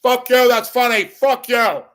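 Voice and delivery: high, loud shouted punchline; mock outburst. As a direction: yell it at the crowd like a comic. Comic's punchline shouts at audience.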